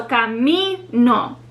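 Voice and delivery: "camino" slowly, MI held and stressed; stress on second-to-last syllable. Shown as a demonstration. Teacher showing the rule, slow stress on MI in "camino". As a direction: draw out MI in "camino", drop on NO. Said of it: "second to last syllable is the one that's stressed".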